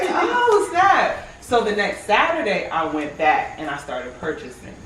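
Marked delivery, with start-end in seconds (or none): 0.0-1.1 high, loud delighted exclamation; thrilled surprise at her glowing face; amazed. Retold or imitated. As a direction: squeal it out in amazement. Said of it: "I was like".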